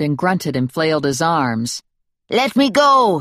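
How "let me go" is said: high, loud yell squeezed by effort; small man held at arm's length, pinched yell; strained yell. Acted. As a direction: yell it while struggling in someone's grip. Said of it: "he yelled, his voice pinched".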